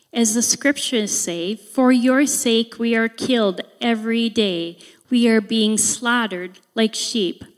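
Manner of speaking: loud, solemn preaching of the passage; scripture reading. Acted. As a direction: proclaim it to the church with full force. Reads scripture to congregation.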